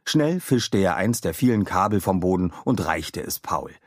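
plain reading voice

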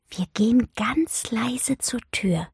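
slow, conspiratorial whisper. A narrator's reading. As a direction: whisper a secret plan, drawing it out. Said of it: "flüsterte sie". (verschwörerisch geflüstert)

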